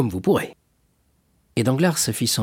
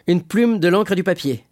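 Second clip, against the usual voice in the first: high, raised-voice order; calling out an order; 9 semitones higher, 4 dB louder than usual. Acted. Danglars orders writing things from the waiter, calling out.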